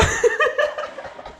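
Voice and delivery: high, wheezy burst of laughing; helpless laughter. Real, not acted. Streamer losing composure over the clip, helpless laughing. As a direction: collapse into real laughter. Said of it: "don't think I'm gonna make it".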